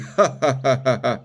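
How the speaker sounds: a short mocking laugh as the Lord. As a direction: laugh at their calamity, scornfully.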